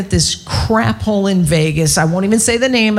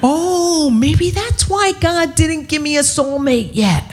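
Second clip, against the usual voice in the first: higher, drawn-out mock realization; mock epiphany; 6 semitones higher than usual. Comic confessing to the crowd why she is single, mock epiphany. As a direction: play up a sudden realization.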